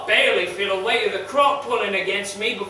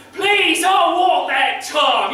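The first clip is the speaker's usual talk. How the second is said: anguished cry; 7 semitones higher, 6 dB louder, slower than usual. Acted. Pleading turns to loud demand.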